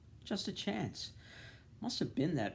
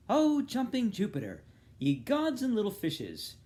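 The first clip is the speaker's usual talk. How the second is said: put-on high, loud girlish exclaiming; tomboy oaths; 11 semitones higher, 7 dB louder than usual. Bloom mimicking his daughter's tomboy oaths, high and loud. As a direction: toss out a daughter's mock oaths. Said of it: "Her tomboy oaths".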